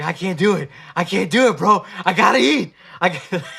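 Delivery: hammed-up panic about needing food; desperate panic. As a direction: beg yourself to quit, loud and frantic. Panicking to give up fast.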